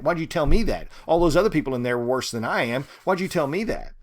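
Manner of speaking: loud, demanding, angry at God; furious demand. Acted. Ruby demanding why she was told, loud and furious. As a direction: fling the question at heaven.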